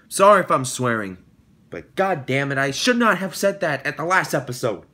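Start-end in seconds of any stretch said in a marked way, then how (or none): none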